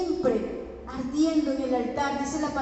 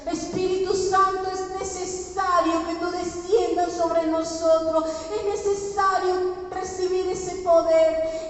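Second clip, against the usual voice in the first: raised, pleading prayer voice; fervent prayer; 5 semitones higher, 3 dB louder than usual. Real, not acted. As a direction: implore God on behalf of the congregation. (oración ferviente y suplicante)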